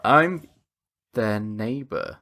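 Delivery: slow, dropping low and soft after a pause; hesitate, then slide out the fib; shifty, drawn-out cover story; obvious lie. Acted. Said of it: "it sounds like you're just lying".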